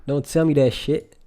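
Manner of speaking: usual speaking voice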